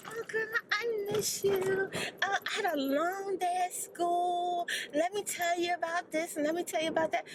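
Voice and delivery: lightly voiced child; granddaughter voice. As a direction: play the little girl missing grandma.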